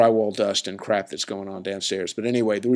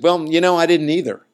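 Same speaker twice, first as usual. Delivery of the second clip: joking comeback; 8 semitones higher, 8 dB louder than usual. Retold or imitated. Retold joking comeback to sound guy.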